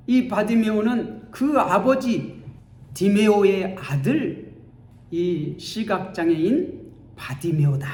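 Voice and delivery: ordinary talk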